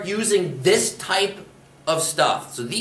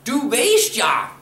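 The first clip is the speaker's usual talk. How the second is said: slow, high "Du weißt ja" with "weißt" stressed; exasperated emphasis; 8 semitones higher, 3 dB louder, slower than usual. Shown as a demonstration. To someone told fifty-five times: slow, high, exasperated. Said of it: "telling this person fifty-five times".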